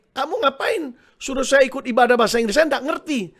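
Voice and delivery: acted-out scolding of a husband; mock angry complaint. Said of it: "ibadah Inggris marah dia".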